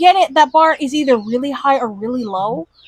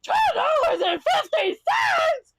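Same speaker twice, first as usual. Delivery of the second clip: much higher, slow, cartoon shriek of disbelief; stunned dog; 14 semitones higher, 8 dB quieter than usual. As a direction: squeal the price in amazement. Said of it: "the dog turns around and is like".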